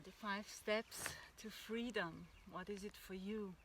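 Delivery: ordinary talk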